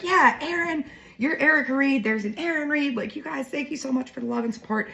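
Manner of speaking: high-pitched, gushing retold thank-you. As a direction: act out thanking fans in a high voice. Retold gushing thanks to two fans.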